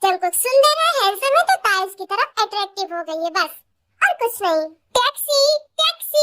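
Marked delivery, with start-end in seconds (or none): 5.0-6.2 higher call for a taxi. Acted. A passerby hails a taxi, calling out. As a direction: flag down a cab.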